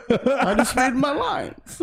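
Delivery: slow, high impression breaking into laughter; Christopher Walken. Co-host quoting the actor, laughing impression. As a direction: stretch it out, laughing through it. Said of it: "the story about Christopher Walken".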